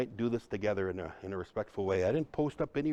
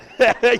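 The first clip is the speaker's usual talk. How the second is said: loud, high burst of laughter; hearty laugh; 11 semitones higher, 13 dB louder than usual. Real, not acted. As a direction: laugh out loud at your own joke. Laughs at his own joke.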